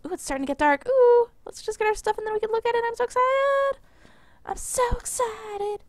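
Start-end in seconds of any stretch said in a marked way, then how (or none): 0.9-2.8 excited. Real, not acted. Excited about the night view. 2.9-3.8 squeaky high excited voice; voice cracking. Real, not acted. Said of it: "My voice just cracked really bad". 4.5-5.8 high excited voice breaking; slower, cracking squeal of excitement. Real, not acted. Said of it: "My voice just cracked really bad".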